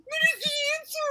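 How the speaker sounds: very high, fast, tear-choked question; crying plea. Retold or imitated. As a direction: plead through tears in a squeaky voice. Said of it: "so I'm crying".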